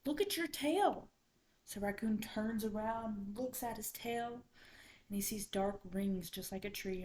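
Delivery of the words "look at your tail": high, loud shock, falling at the end; astonished exclamation. A narrator's reading. Everyone to Raccoon about his tail, high astonished cry.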